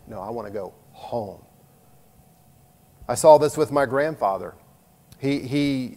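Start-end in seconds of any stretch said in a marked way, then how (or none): 0.1-1.4 un home calme et définitif, voix basse; reply softly: I wanna go home; quiet firm reply. Retold or imitated. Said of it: "And they".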